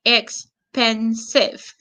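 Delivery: "Expensive" slow, in three separated syllables; three measured beats on "Expensive". Shown as a demonstration. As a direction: count out "Expensive" as ex, pen, sive. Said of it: "One, two, three".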